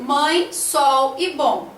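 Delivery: "sol e bom" said slowly and deliberately; slow example words. Shown as a demonstration. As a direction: set out each word slowly for the class. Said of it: "não dá pra separar em sílabas". (devagar, palavra por palavra)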